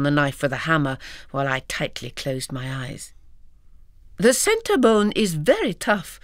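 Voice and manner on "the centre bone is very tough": explaining grandmother. Acted. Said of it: "she explained".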